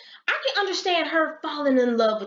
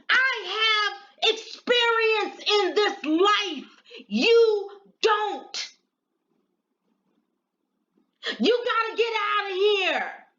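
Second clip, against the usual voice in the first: put-on much higher, scolding as the angry older woman; furious scolding; 8 semitones higher than usual. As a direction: lay into her, furious and high-pitched.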